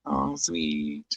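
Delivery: ordinary talk, nothing marked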